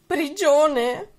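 high, loud alarmed question; shocked echo. Acted. Una bambina ripete la parola del maestro, sconvolta. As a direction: gasp the word back in disbelief. Olivia shocked by teacher's word.

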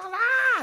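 high, loud mock childbirth scream; imitated scream. Reactor mimicking mothers giving birth, loud mock scream. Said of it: "meta a gritar".

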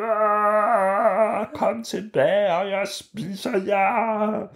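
high, slow, stretched monster voice; a hammed-up monster calling after them; mild monster call. A narrator's reading. The cheese monster calls the fleeing heroes back, slow and high. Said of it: "stod monsteret og råbte".

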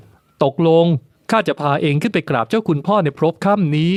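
coaxing lord. Acted. The lord coaxing Ping to nurse their baby first. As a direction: coax a sulking woman to go feed the baby. Luang gives in.

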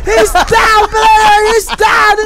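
high, loud play-by-play yell; man down shout. Real, not acted. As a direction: shout the kill like a hype caster. Caster yelling player elimination.

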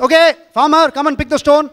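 calling out. Retold or imitated. Landlord calls the farmer before the whole village, loud and high. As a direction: summon him loudly, voice up high.

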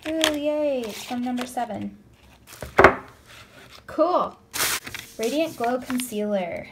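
excited. Real, not acted. Opening a surprise package.

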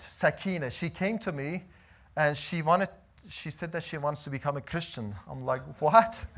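usual speaking voice

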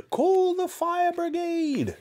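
put-on high, slow, frantic old-woman cry; panicked grandma. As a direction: cry out like a terrified granny.

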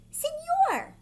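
high, loud squawk of a bird; squawking toucan. Acted. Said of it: "squawked the toucan".

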